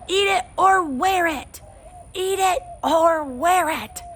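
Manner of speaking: put-on high, slow, drawn-out toddler yell; a small boy screaming a tantrum chant, slowly. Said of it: "Fudge screamed".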